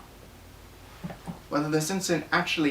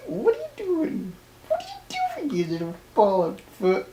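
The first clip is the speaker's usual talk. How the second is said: surprised; 11 semitones higher, 4 dB louder than usual. Real, not acted. Startled by a pet.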